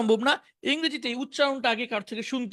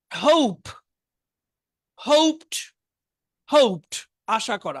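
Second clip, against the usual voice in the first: louder, slow, separated words for repeating; vocabulary drill; 6 dB louder, slower than usual. Shown as a demonstration. Teacher reciting verb forms to the class, measured drill delivery. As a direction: call out each verb form, then its meaning.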